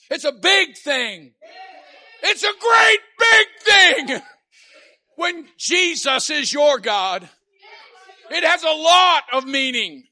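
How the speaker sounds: high, loud, fervent testimony; preaching at full force. Real, not acted. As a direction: testify with the whole chest. Preaching climax.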